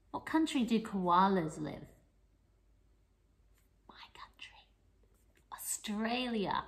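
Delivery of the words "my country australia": secret little whisper. Pretend. Telling little listeners the answer in a whisper.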